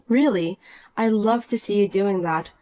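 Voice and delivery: ordinary delivery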